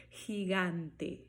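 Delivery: gigante slowly, open A before N; slow gigante, no nasal A; unnasalized gigante. Shown as a demonstration. Teacher showing gigante without nasal sound. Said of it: "ele não se nasaliza".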